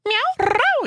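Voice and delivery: a squeaky, very high meow; high cat meow. Pretend. Meowing at the cat, a very high squeak.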